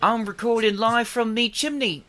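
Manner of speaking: high-pitched British chimney sweep; British accent. Pretend. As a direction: put on a hammed-up British urchin accent. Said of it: "Oh, we British today".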